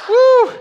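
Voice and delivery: high, loud embarrassed whoop; shrieking woo at an awkward memory; excited whoop. Real, not acted. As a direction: let out a sudden yelp of cringe.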